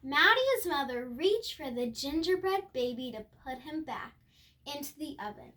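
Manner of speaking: usual speaking voice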